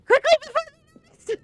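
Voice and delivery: very high, loud rushed squeal; frantic urging. Real, not acted. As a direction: shriek it, racing the clock. Racing against time in game.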